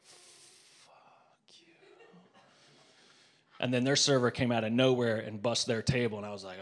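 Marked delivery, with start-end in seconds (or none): 0.0-1.7 unvoiced whisper as she walks past; whispered curse. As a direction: hiss it so she barely hears. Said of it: "I was just like".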